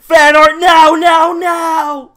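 shouted high and loud through laughter; screaming demand mid-laugh. Real, not acted. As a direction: yell it while cracking up. Friend shouting excitedly, laughing.

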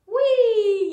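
long, loud whee sliding down; high playful squeal of "whee"; gleeful whee. Pretend. Teacher drawing a line for kids, gleeful whee.

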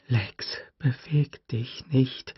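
slow, soft whispered plea; urgent whisper. A narrator's reading. Tim silently begging his sister Lex, whispered plea. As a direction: whisper it, barely breathing. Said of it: "dachte Tim".

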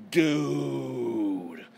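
amazed outburst. Real, not acted. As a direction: stretch it out in awe. Awed reaction to the page.

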